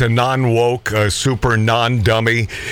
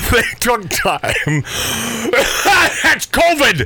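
breaking up laughing mid-line; words squeezed out through laughter; 10 semitones higher than usual. Real, not acted.

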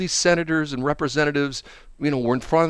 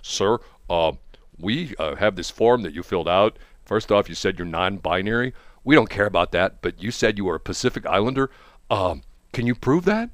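low, official agent voice; lightly voiced federal officer; FBI agent; 8 semitones lower than usual. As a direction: question them like a hesitant official. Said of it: "watch the F B I show up my door".

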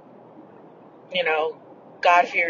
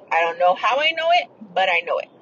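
ordinary talk

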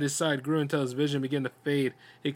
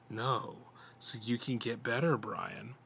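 soft creature voice; 3 semitones lower, 7 dB quieter, slower than usual. A narrator's reading. Creature speaks softly.

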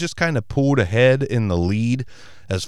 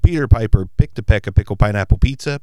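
tongue twister test; 6 dB louder, faster than usual. Shown as a demonstration. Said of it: "put it through its paces".

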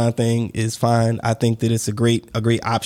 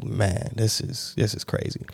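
quiet, half-whispered murmur of disbelief; hushed disbelief; 4 semitones lower, 8 dB quieter, slower than usual. Retold or imitated. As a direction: mutter it to yourself, stunned. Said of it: "I was just like".

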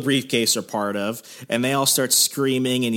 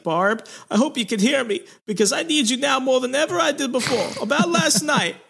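high-pitched, hammed-up pleading young man; John Travolta. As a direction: plead melodramatically at a bedside in a put-on voice. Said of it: "continue doing this voice throughout the show".

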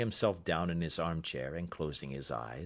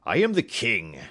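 declaring; 5 semitones higher, 9 dB louder than usual. A narrator's reading. King reveals himself.

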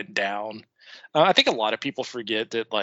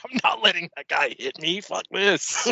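imitating a fleeing wrestler; 6 semitones higher than usual. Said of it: "He's like".

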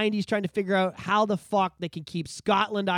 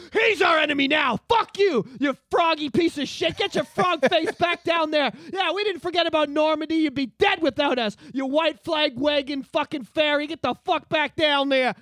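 high, loud tirade of insults; mock collective yelling; 7 semitones higher, 5 dB louder than usual. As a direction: rant at the enemy, hammed up. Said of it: "They're all yelling".

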